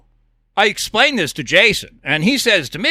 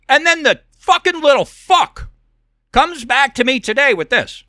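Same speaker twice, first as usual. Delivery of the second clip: higher-pitched, exasperated outburst; angry cursing; 5 semitones higher than usual. Real, not acted. Host cursing the man who messaged him, angry outburst. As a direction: curse him with emphatic disgust.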